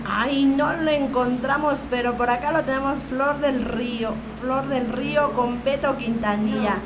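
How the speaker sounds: quiet aside while hunting for the song; muttering to himself. Real, not acted. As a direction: mumble it low as if looking for a file. Host searching track, off mic.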